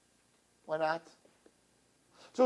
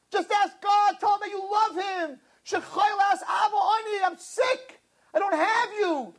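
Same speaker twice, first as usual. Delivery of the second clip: high, pleading angel's rebuke; impassioned rebuke; 11 semitones higher, slower than usual. Acted. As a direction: urge them to just ask for God.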